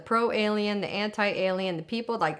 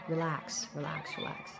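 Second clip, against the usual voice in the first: softer, fading hushed relax; calm her down quietly, almost breathed; soothing beings; 4 semitones lower, 8 dB quieter than usual. Retold or imitated. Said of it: "And they were saying".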